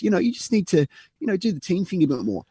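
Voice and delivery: boss voice. Retold or imitated. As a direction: lecture like a clueless boss. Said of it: "he's like, Jared".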